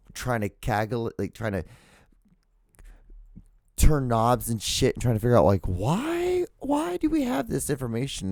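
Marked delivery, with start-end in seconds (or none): 5.7-8.3 softly pleading, pitched way up; puzzled wailing why. Retold or imitated. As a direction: stretch "why" high in mock anguish. Acts out puzzling over it.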